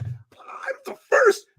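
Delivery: high whispered disbelief, slow; amazed whispered question; stunned whisper. Retold or imitated. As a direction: gasp it out in wonder. Stunned at being first.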